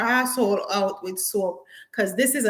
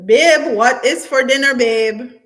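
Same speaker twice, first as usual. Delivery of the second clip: loud, raised call across the house; 3 semitones higher, 9 dB louder than usual. Real, not acted. Calling partner in another room about dinner, loud. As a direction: call out loudly to babe.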